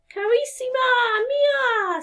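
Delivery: high, slow, loving Italian woodcarver; Italian endearment. Acted. Woodcarver tenderly greeting his wife, slow and high. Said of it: "said the woodcarver".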